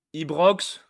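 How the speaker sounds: exclaimed name. Real, not acted. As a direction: announce Ibrox with a lift. Lit le nom du stade. (Ibrox lancé fort, montant)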